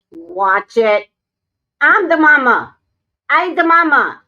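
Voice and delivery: loud, firm warning; stern warning. Real, not acted. Owner warning the cat she is boss, loud and stern. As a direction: put the cat in its place.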